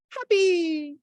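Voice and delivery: high, loud cheerful burst; son's happy act. Retold or imitated. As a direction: beam it out like a kid playing happy. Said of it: "he made this big smile".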